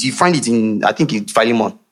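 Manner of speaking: normal talking voice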